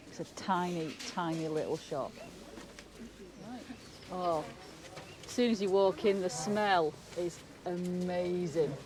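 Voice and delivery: muffled through a face mask; speaking through a mask; hand over mouth. Real, not acted. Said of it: "sorry if I'm a bit muffled".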